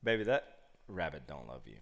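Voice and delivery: low, soft letdown; deadpan dad. Retold or imitated. Dad correcting his daughter about rabbit, deadpan. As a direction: break it to the child quietly and low.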